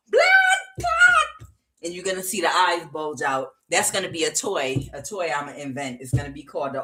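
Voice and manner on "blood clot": very high, loud toy shriek; screaming wind-up toy. Acted. Imagined Jamaican wind-up toy going off, high mock scream. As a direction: squawk it out as an overly emotional toy. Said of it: "this thing is gonna be like".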